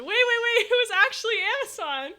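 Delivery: high, loud disbelieving yell; excited shout. Real, not acted. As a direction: shout the news at chat, thrilled. Shocked the delivery was real.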